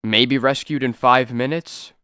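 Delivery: normal talking voice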